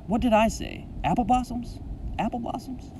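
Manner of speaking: high-pitched baffled questioning; dawning realisation. A narrator's reading. Boy realising what the words really were, high and puzzled. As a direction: question it high, as it dawns on you. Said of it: "I think to myself".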